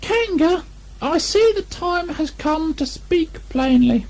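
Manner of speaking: very high, slow, squeaky attempt at firmness; a tiny pig standing up for himself; Piglet's brave squeak; bravest voice. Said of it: "in his bravest voice".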